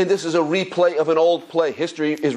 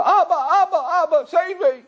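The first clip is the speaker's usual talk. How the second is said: high, laughing mock panic of a small boy; hammed-up child fleeing dogs; child crying for help. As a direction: shriek for daddy like a frightened kid, half laughing. Child chased by wild dogs.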